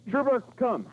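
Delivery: high, loud call for the dog. Real, not acted. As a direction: shout it out to the dog. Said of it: "Say it loud".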